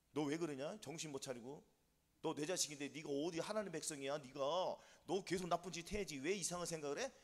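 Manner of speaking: low, hushed voice of the tempting devil; devil's whispering. Acted. Devil scolding a believer for trying to be holy, low murmur. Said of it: "속삭여, 안 속삭여?".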